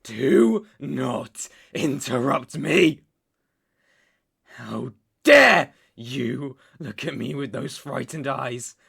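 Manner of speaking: loud, forceful, spaced-out words; furious rebuke. Acted. Captor rebuking his frightened captive, furious rebuke. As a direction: scold with hard-hit words.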